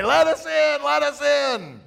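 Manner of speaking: high, loud shouted demand at the gates. Retold or imitated. Moses whacking the Jericho gates, shouting loud and high. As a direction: pound on the gates with your voice.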